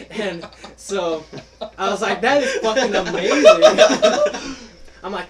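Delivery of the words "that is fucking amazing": high, loud, slowed through laughter; laughing. Retold or imitated. Telling friends the prank worked, laughing hard. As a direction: blurt it out while cracking up. Retelling own delighted reaction.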